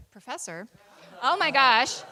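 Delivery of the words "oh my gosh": much louder burst of surprise; loud exclamation. Real, not acted. Host caught out while introducing the president, loud exclamation. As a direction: blurt it out over the audience.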